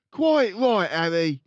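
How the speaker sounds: loud, high nagging mum; shrill mother. Acted. Mother agreeing with Harry, loud and high. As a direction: back up your husband sharply.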